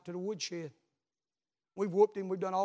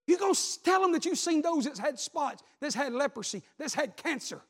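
much higher and louder, shouting the refrain; fiery climactic sermon; full-force preaching; 6 semitones higher, 9 dB louder than usual. Acted. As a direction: whoop it up like a revival climax.

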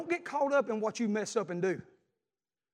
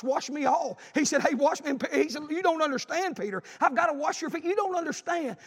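animated retelling; 8 semitones higher, 4 dB louder than usual. Acted. Acted exchange between Jesus and Peter.

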